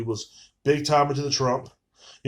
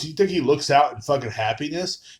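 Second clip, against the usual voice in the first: ordinary talk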